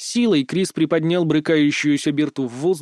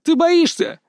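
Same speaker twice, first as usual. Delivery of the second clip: very high, sharp taunt; crying challenge; 14 semitones higher, 4 dB louder than usual. A narrator's reading. Berta, still struggling, dares Kris, crying out very high. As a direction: fling the question out high, as a dare.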